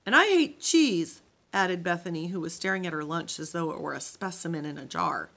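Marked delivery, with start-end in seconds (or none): 0.1-1.2 louder, higher, slow disgusted whine; indignant complaint. Acted. Girl at camp lunch complaining about cheese, loud whine. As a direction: whine it like a kid who hates her lunch.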